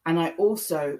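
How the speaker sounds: normal talking voice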